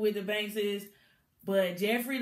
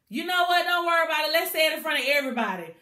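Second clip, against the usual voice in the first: higher and louder, fast at the end; defiant; 7 semitones higher, 5 dB louder, much faster than usual. Retold or imitated. Hillary interrupting LaMarcus in front of everyone, defiant. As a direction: cut him off and call it out.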